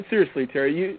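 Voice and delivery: usual speaking voice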